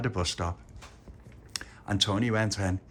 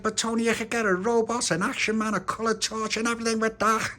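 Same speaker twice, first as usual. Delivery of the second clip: Little Pappy; 14 semitones higher, 3 dB louder than usual. Acted. Little Pappy tempting Tony.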